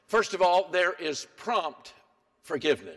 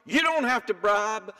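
usual speaking voice

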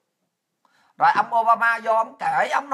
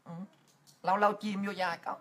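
ordinary talk

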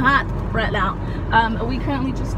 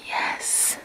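soft unvoiced whisper; 14 dB quieter, much slower than usual. Real, not acted. Checking if baby asleep.

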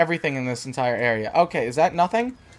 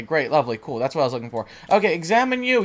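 usual speaking voice

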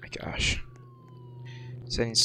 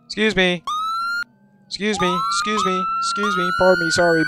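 polite calling out; faster than usual. Pretend. Pushing through a crowd.

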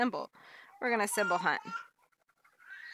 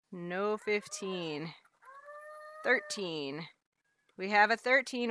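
normal talking voice

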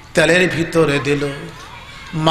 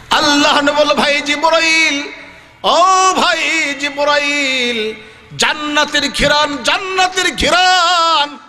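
high, chanting sermon delivery; full-force preaching; 10 semitones higher than usual. Acted. Prophet asks Gabriel about paradise's fragrance, high impassioned preaching. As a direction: cry out the dialogue with long-held endings.

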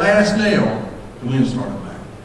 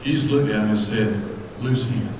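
hushed; 9 semitones lower, 10 dB quieter than usual. Acted. Preacher drops to hush.

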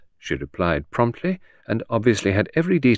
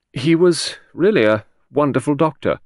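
admiring, but grudgingly, higher and louder; a touch of grudging admiration; 7 semitones higher, 5 dB louder than usual. A narrator's reading. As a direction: concede the praise with some reluctance. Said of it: "almost grudging admiration in her voice".

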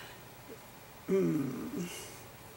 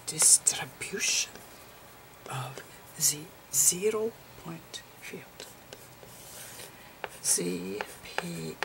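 whispered lecture. Acted. Lecturer explaining photon slices to viewers, whispering.

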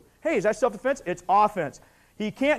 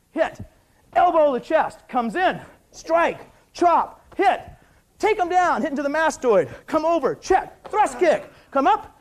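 sharp, punchy, higher-pitched calls over each move; barked fight commands; 6 semitones higher, 6 dB louder, slower than usual. Shown as a demonstration. Instructor calling out moves to students, barked commands. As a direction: call out every strike like a live drill.

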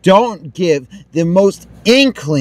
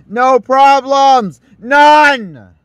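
slow, then a louder higher shout; building angry yell; 5 semitones higher, 3 dB louder, much slower than usual. Real, not acted. Gamer berating his broken save, rising angry shout. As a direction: hammer it home, louder each time. Raging at the game.

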